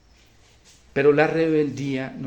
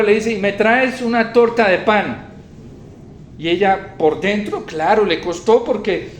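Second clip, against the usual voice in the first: loud, raised preaching voice; forceful preaching; 8 semitones higher, 8 dB louder than usual. Real, not acted. Preacher retelling the widow's obedience to the congregation, forceful preaching. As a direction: proclaim the story with force.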